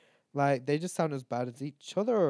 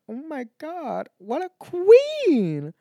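much higher, slow, loud fan squeal; excited gushing; 13 semitones higher, 9 dB louder, much slower than usual. Retold or imitated. Fan squealing about her Irish drinking idol, excited. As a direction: gush over her like a superfan. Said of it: "I'm like".

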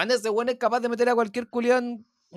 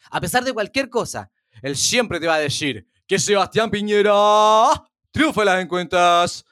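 Javier Olivares impression; 5 semitones lower than usual. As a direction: lower anchorman voice, measured and serious. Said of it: "tu imitación está muy buena".

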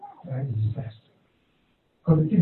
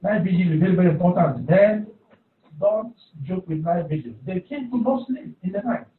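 usual speaking voice

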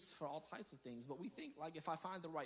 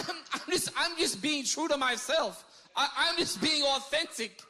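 high, loud hammed-up protest; mock self-justification; 10 semitones higher, 13 dB louder than usual. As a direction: wail it out in mock earnestness, drawn out. Mocks TikTok people defending themselves.

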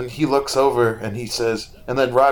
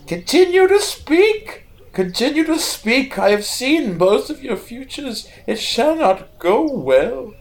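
a possessed prophet suddenly roaring out his vision; ominous prophecy; 13 semitones higher, 4 dB louder than usual. Acted. Hooded seer waking in the carriage to warn the siblings, high and booming.

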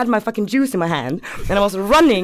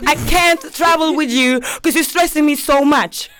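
higher, hammed-up protest; retold quarrel, voice pitched up; exasperated refusal; 6 semitones higher than usual. Girlfriend to boyfriend about travel stress, exasperated.

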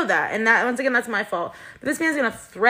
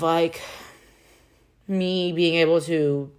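ordinary talk, nothing marked

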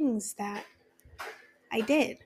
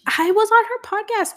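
much higher and louder burst; thrilled; 11 semitones higher, 7 dB louder than usual. Real, not acted. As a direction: squeal the news out. Still thrilled about the podcast.

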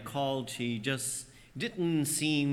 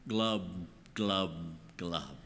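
glub sinking lower and fading to nothing; 8 semitones lower, 5 dB quieter, much slower than usual. Acted. Sinking Peter going under, mock glub sounds. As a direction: let each glub drop and die away.

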